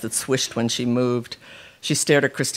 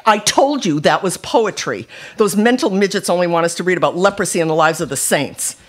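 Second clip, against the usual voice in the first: put-on higher, indignant teenage retort; fired back; 6 semitones higher, 4 dB louder than usual. A narrator's reading. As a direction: argue back with force. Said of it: "Immediately she fired back".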